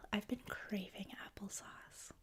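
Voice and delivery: ordinary delivery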